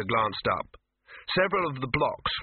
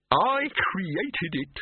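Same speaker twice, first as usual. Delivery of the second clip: very slow, higher gloomy lament; morose; 7 semitones higher, much slower than usual. A narrator's reading. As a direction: drag it out in self-pity. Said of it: "said Silverfish morosely".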